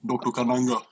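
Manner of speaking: Doctor Kananga in a hammed-up African accent, like the Bond villain; mock African accent. Calling out his alias at the restaurant in a mock African accent. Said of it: "You can ho-, you can do that whole African accent thing".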